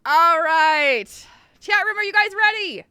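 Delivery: rally the crowd, big and loud; rousing call-out. Real, not acted. Host calling the chat room to start, rousing shout.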